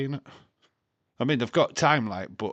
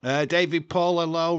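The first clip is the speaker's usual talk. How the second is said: loud greeting; 6 semitones higher, 7 dB louder than usual. Real, not acted. Greeting chat viewer loudly.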